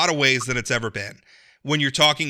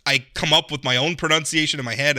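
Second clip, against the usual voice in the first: normal talking voice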